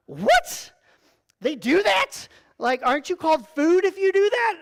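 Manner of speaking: high incredulous "What?" then rising protest; stunned disbelief. Retold or imitated. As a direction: play the shocked tourist who can't believe it. Retold shock at shark swim.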